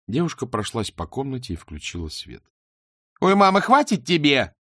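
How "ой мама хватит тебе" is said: higher, louder snap at mother; snapping. A narrator's reading. Daughter snaps at her tied-up mother, fed up and loud. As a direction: bark it out, fed up. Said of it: "рявкнула она".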